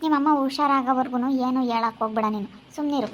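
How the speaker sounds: normal talking voice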